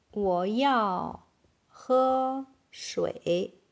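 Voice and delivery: very slow, stretched syllables; word by word. Shown as a demonstration. Teacher modelling "I need to drink water", very slowly. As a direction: draw each word out for learners.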